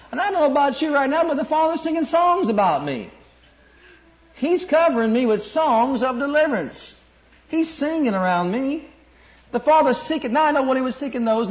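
high, loud, ecstatic preaching; fervent preaching. Real, not acted. Preaching at full force.

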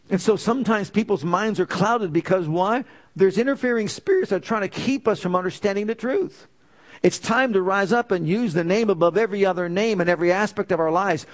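rally the congregation to act; preaching. Acted. Preaching builds to exhortation.

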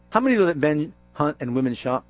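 normal talking voice

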